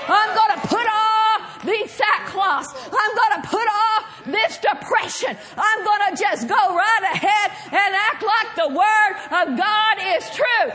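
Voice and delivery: full-voiced, driving sermon delivery; forceful preaching. Real, not acted. Preacher rallying church against the enemy, forceful preaching. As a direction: preach it hard, phrase after phrase.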